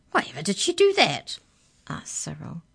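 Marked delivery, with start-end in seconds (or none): 0.1-1.4 high, loud canine character voice; puzzled canine leader. Acted. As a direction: ask in loud, high-pitched bafflement. Canine leader asks in surprise.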